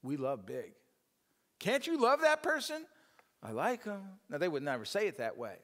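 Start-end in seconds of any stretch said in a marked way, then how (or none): 1.6-4.0 mocking voice. Mimicking people who judge him.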